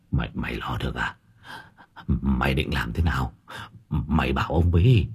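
put-on low, soft, stammering old man; urgent hushed pleading. A narrator's reading. Astonished master asks his servant for help, hushed and eager. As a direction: stumble on the first word, keep it hushed.

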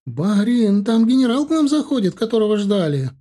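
high, loud hollered announcement; yelling. A narrator's reading. Servant woman calling her master as the general arrives, yelling. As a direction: call out urgently at a high pitch. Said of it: "заорала, юркнув в дверь".

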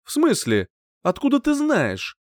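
puzzled questions rising high at the end; surprised. A narrator's reading. Rival learning the guardian spider is gone, surprised. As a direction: question with mild astonishment. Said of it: "удивился мой собеседник".

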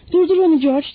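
loud, high cry for help; shouted plea. A narrator's reading. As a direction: cry out to be saved. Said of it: "diye tekrar bağırdı". (yüksek, tiz sesle imdat bağırışı)

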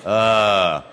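long, low "uh" groan; drawn-out moan of a sick man, demonstrating a vowel; dying-man groan. Shown as a demonstration. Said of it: "un uomo che sta male fa". (lungo gemito da moribondo)

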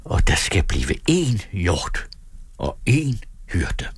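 slow, hushed, consoling whisper; prophetic whisper. A narrator's reading. Carlson promises one flock to his listener, whispering reverently. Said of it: "hviskede han".

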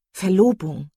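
loud, startled echo of a word; indignant cry. A narrator's reading. Marian seizes on her sister's word engagement, indignant cry. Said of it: "rief Marian".

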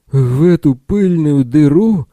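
loud hoarse shout, high and slow; hoarse yell. A narrator's reading. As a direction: bellow it hoarsely in outrage. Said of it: "хрипло заорал Билл".

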